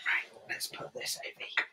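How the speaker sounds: unvoiced aside whisper. Real, not acted. Host tidying the table between segments, whispered aside. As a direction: murmur it off-mic. Quietly moving things aside.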